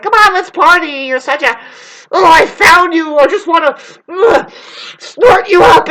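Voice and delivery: high, loud, wailing mock party voice; mocking a party leech. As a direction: ham up a clingy partier howling for her. Mocking party people who use her.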